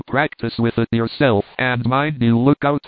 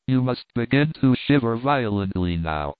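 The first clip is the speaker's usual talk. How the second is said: usual speaking voice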